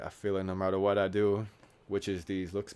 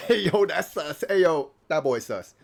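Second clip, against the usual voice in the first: higher, louder hammed-up bro; mock bro voice; 9 semitones higher, 10 dB louder than usual. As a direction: play the loud bro calling someone sus. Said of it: "I'm like".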